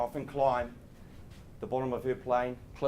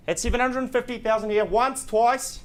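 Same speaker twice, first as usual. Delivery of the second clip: higher, louder auction call; auctioneer; 8 semitones higher, 5 dB louder than usual. Real, not acted. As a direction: sell it like closing a lot. Auctioneer calling the count.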